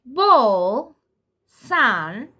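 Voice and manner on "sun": normal talking voice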